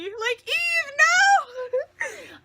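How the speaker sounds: high, loud gleeful shriek; high delighted shriek of no; squealing protest. Real, not acted. Shouting at the character.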